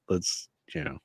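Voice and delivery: breathy hushed aside, barely voiced; whispered aside. Real, not acted. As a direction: drop to a whisper as if to himself. Trailing off mid-argument.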